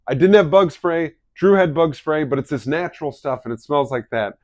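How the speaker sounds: high, loud insistent protest; husband insisting loudly to his wife. Retold or imitated. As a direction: swear up and down you're innocent. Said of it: "they swore up and down".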